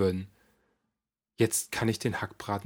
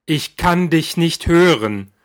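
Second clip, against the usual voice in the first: much louder, slow insisting; loud demand; 7 semitones higher, 11 dB louder, slower than usual. Acted. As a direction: push her to speak up. Demanding mother repeat.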